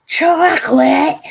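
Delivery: high, loud, slow drawn-out shout of chocolate; crazed chocolate shout. Retold or imitated. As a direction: scream chocolate like a man obsessed. Quoting the crazy chocolate guy.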